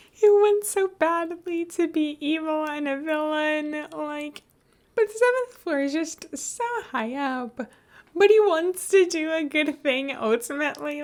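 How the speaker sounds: high, gushing fangirl voice; gushing fan. Real, not acted. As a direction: gush about a favourite character. Gushing fan chat, put-on high voice.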